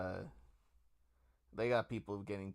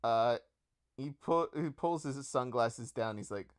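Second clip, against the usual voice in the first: ordinary talk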